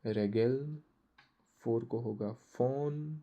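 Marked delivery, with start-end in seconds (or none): 0.0-0.8 रेगेल said as German "ray-gel", both e long; German word Regel. Shown as a demonstration. Teacher giving German for rules: careful German रेगेल. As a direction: model रेगेल clearly in German. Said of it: "rules को German में बोलते हैं".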